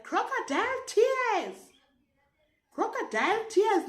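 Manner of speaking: imitated yell. Teacher passing through class taunts the pupil, imitated yell. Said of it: "she yelled in our class".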